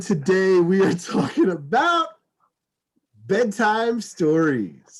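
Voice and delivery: slow, drawn-out host announcement; announcer. Acted. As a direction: present the topic like a showman. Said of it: "So, ladies and gentlemen".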